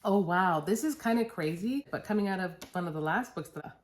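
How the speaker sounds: usual speaking voice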